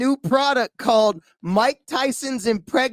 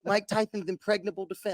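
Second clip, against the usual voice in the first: softer lisping boxer tagline; Mike Tyson; 7 dB quieter than usual. Acted. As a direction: sign off the mock ad quietly, with a lisp. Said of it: "Mike Tyson comes and punches him".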